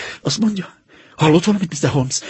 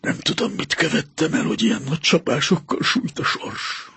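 hushed, half-whispered despair; broken lament; 7 dB quieter than usual. Acted. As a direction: barely voice the words, crushed.

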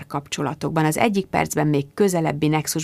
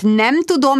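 ordinary delivery

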